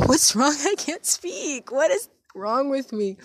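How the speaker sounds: high, stretched-out wail of frustration; half-laughing, high-pitched exasperation; exasperated outburst. Real, not acted. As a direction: wail at your own tongue-tied fumbling. Exasperated at own fumbled speech.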